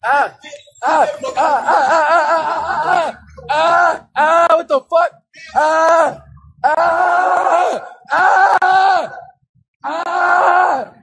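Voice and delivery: high, panicked cries of real fright; real frightened screaming. Real, not acted. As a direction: scream in real terror, over and over. Startled by a jump scare.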